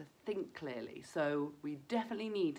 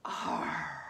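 pirate sigh. Shown as a demonstration. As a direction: let the breath go gently. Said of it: "out through our mouths".

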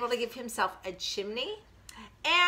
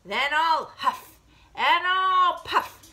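loud wolf threat, swelling higher and longer; threatening big bad wolf; 6 semitones higher, 5 dB louder, slower than usual. Wolf threatens the piggy, loud, slow and building. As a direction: build each threat, stretching the key word hugely.

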